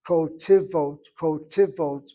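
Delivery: "Ko tivot" in three syllables with an open KO; careful Hebrew pronunciation. Shown as a demonstration. Teacher modelling better Hebrew for students, each syllable separated. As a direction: repeat "ko tivot", sounding a short vowel after the t. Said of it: "speak a better Hebrew".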